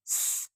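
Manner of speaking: drawn out स hiss; a slow hissing स; s sound. Shown as a demonstration. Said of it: "S sound आ रहा है". (धीमा स)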